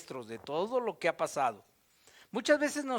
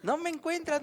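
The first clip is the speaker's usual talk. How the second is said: put-on much higher, teasing little child; child's voice; 8 semitones higher than usual. A hiding child teasing the one searching. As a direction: tease like a kid playing hide and seek. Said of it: "lo hacen así".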